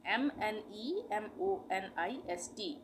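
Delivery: ordinary talk, nothing marked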